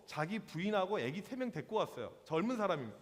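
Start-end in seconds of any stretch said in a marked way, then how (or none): none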